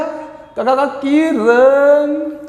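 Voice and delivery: very slow stammered का- का- का- then Kiran; Shah Rukh Khan stutter. Retold or imitated. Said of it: "कैसे बोलता है". (शाहरुख खान वाला हकलाना)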